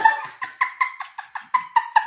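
high loud burst of laughter. Real, not acted. Big laugh.

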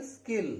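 स्किल with a plain K after S; no puff of air on the K in स्किल; unaspirated K. Shown as a demonstration. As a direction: demonstrate स्किल with a dry K. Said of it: "कै को कै ही पढ़ा जाएगा".

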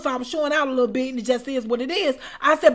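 usual speaking voice

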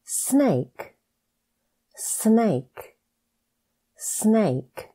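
ordinary talk

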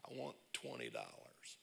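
low, soft voice of the Lord; voice of God. Acted. The Lord speaking to a churchgoer, low soft divine voice. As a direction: drop low and quiet for God's command. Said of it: "And the Lord speaks and says".

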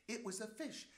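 ordinary delivery

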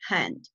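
"hand" with a raspy Spanish J for the H. Shown as a demonstration. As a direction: say "hand" starting on a Spanish jota sound. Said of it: "la H tiene pronunciación de J".

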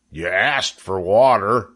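ordinary delivery

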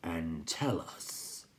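slow, whispery creature voice; crackling. A narrator's reading. Rock Chewer tells the newcomer to sit, slow crackle. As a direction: crackle the order out slowly. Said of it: "Pjorn Krachark crackled".

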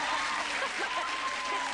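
very high squeal of laughter; high-pitched giggling squeal; shrieking laugh. Real, not acted. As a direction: let out a delighted shriek.

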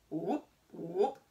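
playful frog call; frog croak. A narrator's reading. Frog sound in story.

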